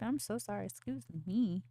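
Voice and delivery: ordinary talk, nothing marked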